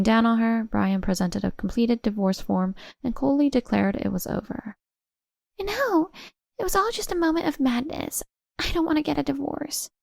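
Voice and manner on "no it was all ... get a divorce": much higher, pleading woman's voice; begging Tracy. Acted. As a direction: plead desperately not to be left.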